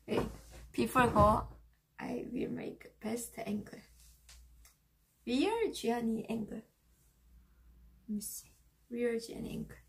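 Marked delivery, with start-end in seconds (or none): 2.0-9.8 whispering. Real, not acted. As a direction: whisper while fiddling. Whispers while adjusting camera.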